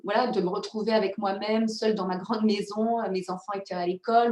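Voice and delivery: ordinary talk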